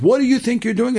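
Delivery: retold officer's outburst, pitched very high; high, indignant storyteller's shout; retold screaming. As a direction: yell it as an outraged challenge, acting it out. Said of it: "He starts screaming".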